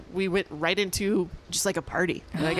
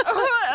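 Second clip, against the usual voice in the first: imitated happy cry; 13 semitones higher, 4 dB louder than usual. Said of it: "and she's like".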